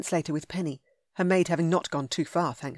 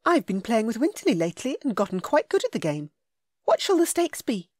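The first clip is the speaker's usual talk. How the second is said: put-on higher, louder young woman; heroine voice; 5 semitones higher, 4 dB louder than usual. A narrator's reading. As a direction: voice her bright and playful. Heroine's lines.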